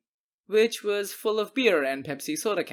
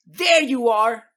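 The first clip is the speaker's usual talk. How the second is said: higher, much louder, slow accusing yell; angry shout; 6 semitones higher, 9 dB louder, slower than usual. Acted. Homeowner storming out at a teen, yelling. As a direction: catch the culprit red-handed.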